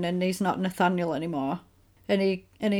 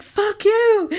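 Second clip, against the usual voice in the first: a much higher, louder retold yell; shouted; 11 semitones higher, 6 dB louder than usual. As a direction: hurl it down the phone and hang up. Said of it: "she just shouts it".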